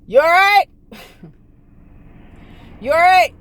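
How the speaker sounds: loud, high call of a concerned stranger. Retold or imitated. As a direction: shout it across to a car in a ditch. Stranger calls from roadside.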